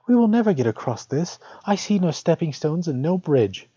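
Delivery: put-on a child fretting at the water; child's voice. A narrator's reading. Hansel dismayed at the lake, lightly high child voice.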